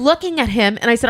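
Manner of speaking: usual speaking voice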